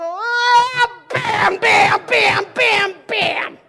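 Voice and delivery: loud, high angry banging shouts; retold outburst. Boy smashing his model in anger, loud shouts. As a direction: smash it with each bam. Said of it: "I get emotional. I go".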